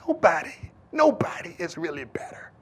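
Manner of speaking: soft near-whisper; hushed emphasis. Real, not acted. Preacher quietly driving home that nobody is better, hushed whisper. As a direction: drop to a breath for the point.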